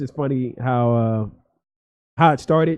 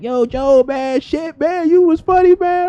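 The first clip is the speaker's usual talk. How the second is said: much higher, loud, hyped friend; my boy; 9 dB louder, slower than usual. Retold or imitated. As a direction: imitate the buddy bursting with news. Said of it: "He's like".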